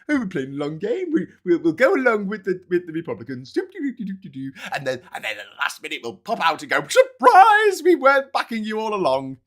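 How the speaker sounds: high, silly whiny voice; mock Democrat. Mocking the Democrats' long game, high silly voice. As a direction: play a smug clueless politician. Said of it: "mocking Democrats' excuse".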